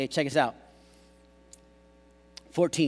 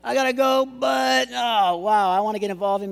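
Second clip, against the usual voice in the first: raised-pitch mock hedging; excuse-making; 6 semitones higher, 5 dB louder than usual. Preacher mimicking reluctant churchgoers, making excuses. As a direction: hem and haw in a higher voice.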